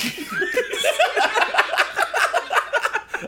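high, helpless laugh; a real, high laugh; high burst of laughter. Real, not acted. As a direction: crack up high. Laughing at the forgetful answer.

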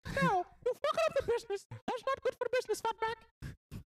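high squeaky mouse boss; Mickey as gangster boss. Acted. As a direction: falsetto cartoon mouse scolding. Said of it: "he do like a Frank".

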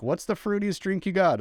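high, playful acted question; playful bar order. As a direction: ask the bartender with unabashed eagerness. Acting out ordering a drink.

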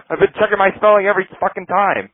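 high, worked-up mock outrage; exasperated protest. Frustrated pilot protesting he keeps checking his spelling, exasperated. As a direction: complain high and indignant.

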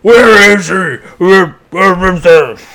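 loud, rasping mock growl; Batman. Parody of a hero's interrogation, loud growl. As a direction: growl it hard, hammed-up and loud. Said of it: "basically like the Batman".

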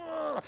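high, strained mock growl of rage; about-to-explode growl. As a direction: imitate someone about to burst with anger. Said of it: "like he was gonna explode".

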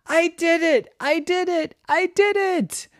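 high, jubilant cheer; shout of joy. Acted. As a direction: exult in a high triumphant voice. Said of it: "they heard a shout of joy".

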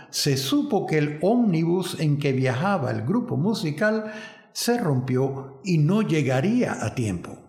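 ordinary talk, nothing marked

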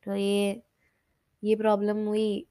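ordinary delivery

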